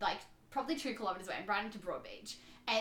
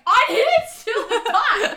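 high, loud, slow, dissolving into laughter; can barely say it for laughing; laughing denial; 13 semitones higher, 17 dB louder, much slower than usual. Real, not acted. Retelling repeated accusation, laughing.

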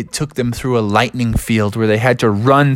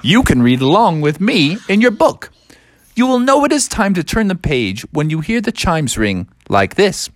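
read-along narrator; 6 semitones higher than usual. Acted. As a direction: announce the book to young readers. Read-along book intro.

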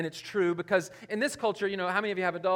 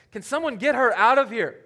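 loud, higher fed-up plea; exasperated and raised, playing to the crowd; exasperated demand; 5 semitones higher, 9 dB louder than usual. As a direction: call out as an irritated disciple. Disciples' exasperated demand acted.

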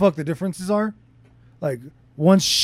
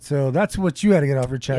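normal talking voice

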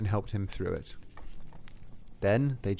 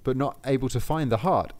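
ordinary delivery

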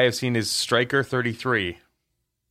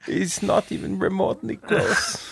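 mock German accent; 9 semitones higher, slower than usual.

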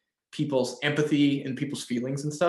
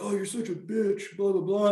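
high-pitched mock cussing-out; hammed-up insult in a put-on higher voice; mock couple's fight; 6 semitones higher than usual. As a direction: imitate a crazy squabbling couple, pitched up. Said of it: "like, kinda crazy, like, cussing at each other".

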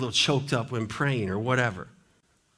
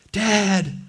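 excited higher shout of Dad; calling out; 6 semitones higher, 4 dB louder than usual. Acted. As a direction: call out to father with joy. Sons calling to father Jacob.

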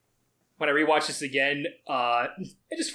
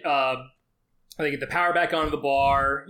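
normal talking voice

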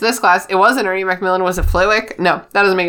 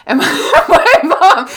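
high, louder, breaking into laughter; cracking up; 9 semitones higher, 5 dB louder than usual. Real, not acted. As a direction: struggle to speak through laughing. Cracks up at own story.

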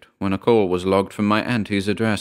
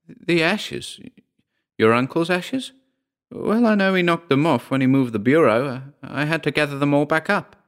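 put-on higher, puzzled older auntie questioning; auntie's voice; 6 semitones higher than usual. Auntie to her nephew about the ashes, higher puzzled voice.

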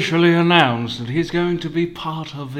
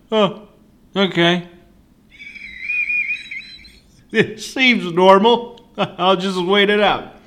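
casual shrug; 8 semitones higher, 5 dB louder than usual. Retold or imitated. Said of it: "I was like".

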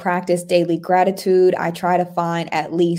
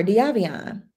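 ordinary talk

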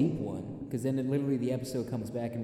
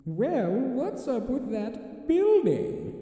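high, slow sly British voice; tempting whisper of a devilish conscience; Pink Panther conscience voice; 12 semitones higher, much slower than usual. Acted. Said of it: "let me see if I can get the voice down".

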